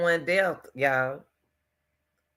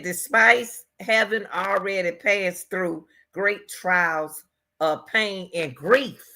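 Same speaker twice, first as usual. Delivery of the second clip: slow quote; slower than usual. Retold or imitated. Quoting the sister slowly.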